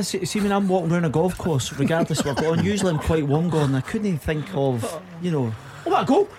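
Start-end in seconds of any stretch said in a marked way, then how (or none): 5.9-6.3 sudden high delighted shout; goal yell. Real, not acted. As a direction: erupt as the goal goes in. Live goal on the radio.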